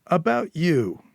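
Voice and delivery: cocky Eddie, lightly voiced. Smug fixer teasing Julia about his mask, lightly voiced. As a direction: lightly play the self-satisfied fixer.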